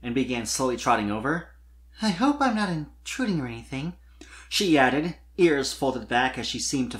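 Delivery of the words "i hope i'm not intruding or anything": put-on shy young mare. A narrator's reading. Sweetie Belle's shy voice.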